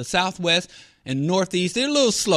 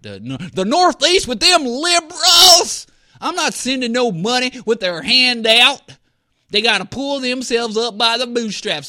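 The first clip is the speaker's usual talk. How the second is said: a loud, sneering put-on Texan politician; mock Texan politician; 6 semitones higher, 5 dB louder than usual. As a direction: sneer at the Northeast liberals, hammed up. Mocking Texas politicians' refusal.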